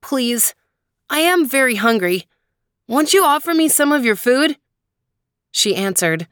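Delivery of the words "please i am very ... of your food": put-on high-pitched pleading boy; hungry boy. A narrator's reading. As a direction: beg for food in a higher young boy's voice. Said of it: "The boy said".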